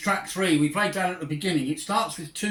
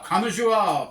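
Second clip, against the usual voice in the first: mock welcoming; 5 semitones higher, 6 dB louder than usual. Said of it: "people say".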